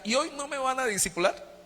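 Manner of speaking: put-on old man turned childlike, begging for his lesson; childlike father. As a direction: ask eagerly like an impatient little kid. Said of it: "Como un niño decía".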